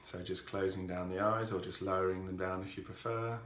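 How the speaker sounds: calm, softened voice with long pauses; guided meditation. Real, not acted. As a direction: ease listeners into stillness, unhurried and even.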